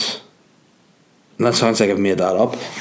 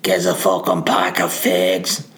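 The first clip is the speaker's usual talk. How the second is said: lightly voiced local, slower and drawn-out; rough Northern Irish local; slower than usual. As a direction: put on a hint of a coarse local accent. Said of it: "They're more like".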